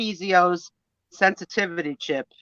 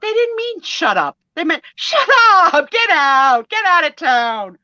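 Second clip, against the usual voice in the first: patient daughter; 10 semitones higher, 6 dB louder than usual. Retold or imitated. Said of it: "She says".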